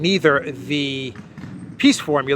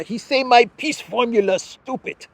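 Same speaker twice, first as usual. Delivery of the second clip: higher, hammed-up imitation of a poor English speaker; mock broken English; 8 semitones higher than usual. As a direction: parody the leader's clumsy English, amused. Mocking Zelensky's poor English.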